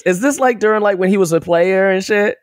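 high, puzzled what-is-this; baffled, pitching way up to ask; surprised disbelief. Real, not acted. Confused by the odd clip.

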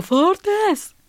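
high-pitched call of the name. Retold or imitated. Dad calling the daughter over, calling out. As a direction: call out like a dad summoning his child. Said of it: "ropar min pappa på mig".

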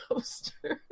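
ordinary talk, nothing marked

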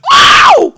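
very high, loud mock shriek; imitated scream. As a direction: mimic a friend's startled squeal. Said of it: "doing my [name] impression".

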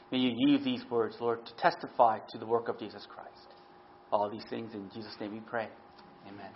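low, hushed prayer voice. Real, not acted. Opening prayer before sermon.

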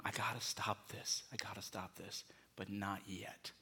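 sinking from soft to a whisper; hushed inner voice. Acted. Preacher voicing the listener's secret excuse, fading to a whisper. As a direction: let the excuse trail off into a whisper.

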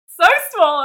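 high, loud mock wail through laughter; mock hurt. Friend playing wounded to her co-host, mock-hurt wail. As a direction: wail it as a playful, laughing complaint.

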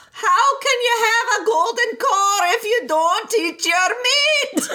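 high mock British shout, laughing; mock British teacher. Teacher scolding children who skip their meat, in a mock British accent. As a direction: yell it like a strict schoolmaster in a posh accent. Sung Pink Floyd quote, accent.

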